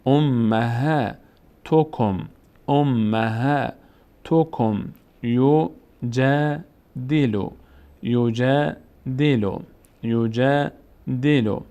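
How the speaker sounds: امهاتکم and یجادلو read very slowly with the long a held; slow, chanted reading; slow recitation. Shown as a demonstration. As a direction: recite each word slowly, stretching the long a.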